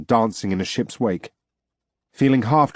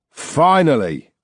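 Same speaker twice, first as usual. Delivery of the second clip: slow, louder and higher exclamation; cried out; 5 semitones higher, 4 dB louder, slower than usual. Acted. Arctian captor greeting the revived narrator, crying out. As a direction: call it out with relief. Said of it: "cried a voice".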